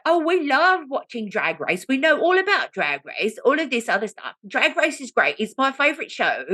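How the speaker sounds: put-on high, gushing English accent; English woman. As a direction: gush like an excited English fan. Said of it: "there's an English woman and her boyfriend".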